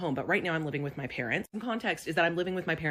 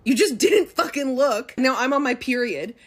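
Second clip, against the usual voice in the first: louder, higher, slow scolding; emphatic shout; 7 semitones higher, 7 dB louder, slower than usual. Acted. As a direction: scold him hard.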